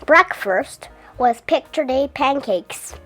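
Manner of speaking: ordinary delivery, nothing marked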